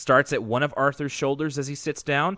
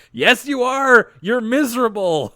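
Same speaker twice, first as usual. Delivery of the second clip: bratty taunt; 10 semitones higher, 7 dB louder, slower than usual. Retold or imitated. Binky taunting Buster.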